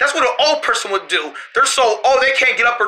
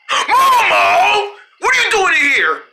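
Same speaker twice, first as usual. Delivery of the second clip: startled shout; 9 semitones higher, slower than usual. Acted. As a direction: shout at Momo for sneaking back in. Spotting Momo back inside.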